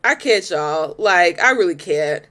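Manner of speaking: dropped low, weary disbelief; exasperated low. Real, not acted. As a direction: sink into a tired I can't. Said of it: "trying my best not to get too in my feelings".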